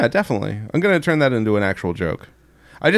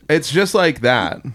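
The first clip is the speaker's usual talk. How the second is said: normal talking voice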